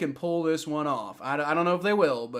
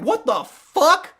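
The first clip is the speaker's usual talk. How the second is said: loud, high-pitched incredulous outburst; outraged disbelief; 7 semitones higher, 6 dB louder, slower than usual. Real, not acted. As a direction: protest a baffling call, voice shooting up. Outraged at taunting call.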